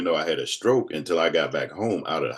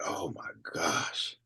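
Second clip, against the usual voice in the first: dismayed hush; 10 dB quieter, much slower than usual. Retold or imitated. Can't name the pictures.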